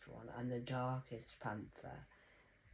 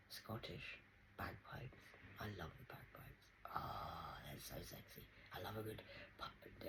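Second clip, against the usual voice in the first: soft breathy whisper, lower and softer; fond whisper; 6 semitones lower, 12 dB quieter than usual. Real, not acted. Swooning over Scottish bagpipes, whispering. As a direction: confide it like a secret crush.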